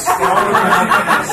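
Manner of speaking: real laughter over straw hats; laughing. Real, not acted. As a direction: crack up as you repeat it. Breaks into laughter on repeat.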